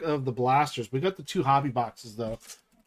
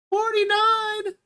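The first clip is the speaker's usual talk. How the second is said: "Forty-nine" much higher, drawn out slowly; excited repeat; 3 dB louder, slower than usual. Real, not acted. Streamer thrilled at the like count, high and drawn out. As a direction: sing out "Forty-nine" in delight.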